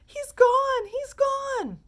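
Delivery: very high, loud shriek; scream. A narrator's reading. Mother screaming after her vanished son, very high and loud. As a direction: shriek in horror. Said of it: "she screamed".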